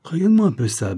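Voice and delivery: high, loud admiring call; excited, lifted voice; exclaiming. A narrator's reading. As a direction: exclaim to show off a find. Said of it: "s'exclama mister Wells".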